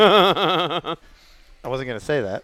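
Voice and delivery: laughing through the words; slow, laughing protest. Real, not acted. Laughing at host's joke.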